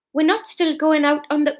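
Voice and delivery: put-on Fiona's line in a light Geordie accent. Fiona asking Norman, lightly in Geordie accent. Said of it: "no, that's Geordie".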